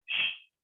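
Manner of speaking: acted shush at a noise; soft warning shush; hushing shh. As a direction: hush someone so you can listen. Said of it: "están escuchando el ruido y están diciendo".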